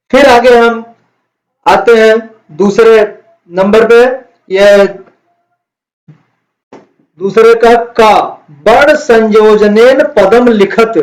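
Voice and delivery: ordinary talk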